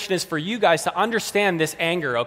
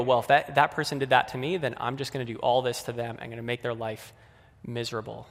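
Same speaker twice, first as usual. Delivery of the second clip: much softer, sinking scheming murmur; passive aggressive; 5 semitones lower, 6 dB quieter than usual. Acted. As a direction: plot revenge quietly to yourself. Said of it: "passive aggressive".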